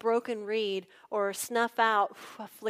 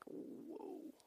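awed whisper; 15 dB quieter than usual. Retold or imitated. Pharisees stunned, walking away.

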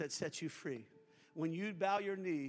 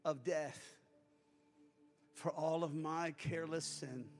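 leading a prayer. Acted. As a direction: pray it gently for them to repeat. Leads a sinner's prayer.

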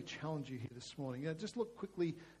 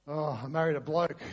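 loud, mock exasperated sigh; frustrated sigh; 2 semitones lower, 7 dB louder than usual. Wife sighing over her husband, mock frustrated. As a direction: imitate a fed-up wife sighing. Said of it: "sometimes in sighs of frustration".

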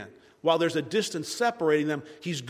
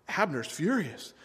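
ordinary talk

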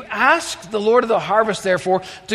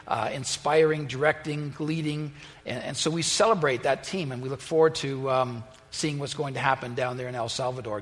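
soft, low prayer voice; hushed pulpit prayer; 6 semitones lower, 6 dB quieter than usual. Real, not acted. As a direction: pray reverently to God. Pastor prays over congregation.

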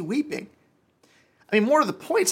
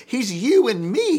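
louder, slow, driven home; emphatic preaching; 7 semitones higher, 7 dB louder, slower than usual. Real, not acted. Preacher telling the congregation they are Lazarus, emphatic. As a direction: hammer home you and me.

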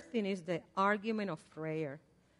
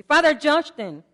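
mock indignant prayer; 6 semitones higher, 7 dB louder than usual. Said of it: "some of the prayers that I might have prayed".

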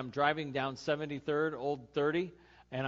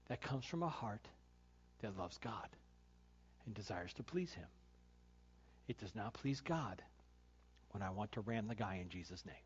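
softer, lower heartfelt preaching; soft sermon; 7 semitones lower, 14 dB quieter than usual. Acted. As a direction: speak gently from the heart to the congregation.

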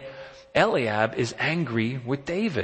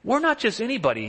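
a touch of indignant pride, pitched up; mild indignant David; 9 semitones higher than usual. Retold or imitated. Preacher voicing David, mildly indignant, pitched higher. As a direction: say it as if insulted that anyone doubts it. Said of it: "he's saying".

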